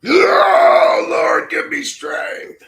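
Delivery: high, shouted, slow wail; mock anguished cry. Streamer in mock despair at the dark realm, wailing high.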